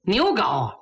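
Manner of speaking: usual speaking voice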